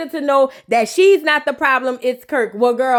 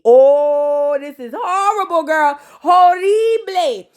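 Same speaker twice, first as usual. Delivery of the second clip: a put-on voice, slow horrified drawl; mock dismay; much slower than usual. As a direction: moan it like a gossip aunt. Speaker in mock character voice.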